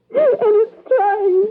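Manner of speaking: tearful, slow. Acted. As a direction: let each word break as it comes.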